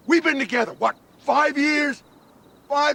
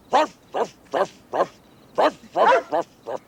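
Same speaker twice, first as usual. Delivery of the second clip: sarcastic pretend dog barks; bitter partner mocking his own obedience with barks; mock barking; 3 semitones lower than usual. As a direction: bark like a lapdog to mock him. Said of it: "I'm like a dog".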